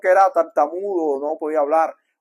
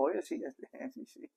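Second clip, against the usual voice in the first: dazed Pharaoh; 18 dB quieter than usual. Retold or imitated.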